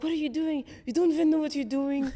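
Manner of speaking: loud, high, mocking jab; bullying classmate. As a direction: bark it at him like a smug know-it-all. Mocking classmate voiced in story.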